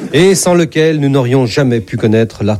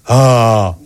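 open-throated "ah" for the doctor; saying "ah"; 2 semitones lower than usual. Acted. God examined by the doctor, obedient "ah". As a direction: hold out a patient's "ah". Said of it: "je vous prie de faire A".

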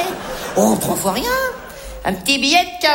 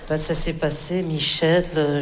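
ordinary talk

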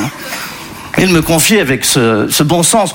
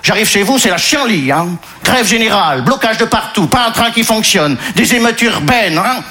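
higher-pitched mock Congolese-Belgian French accent; 5 semitones higher than usual. Disappointed migrant complaining to his host, comic Congolese-Belgian accent. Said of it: "Il vient du Congo belge".

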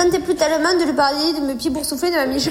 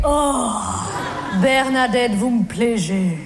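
Jean-Loup smitten; 6 semitones lower, slower than usual. Retold or imitated. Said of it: "il me fait".

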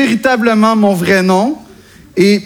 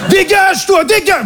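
put-on Parisian accent; 10 semitones higher than usual. Comic mocking a Parisian shooing someone away, high Parisian accent. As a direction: shoo someone off like a cranky Parisian. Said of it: "le parisien de base".

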